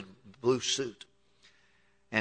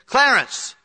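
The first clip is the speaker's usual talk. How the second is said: sharp call of a name; 12 semitones higher, 8 dB louder than usual. Retold or imitated. Old pastor's emphatic retort retold.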